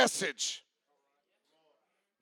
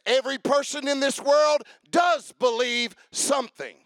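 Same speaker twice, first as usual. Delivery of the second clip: ordinary talk, nothing marked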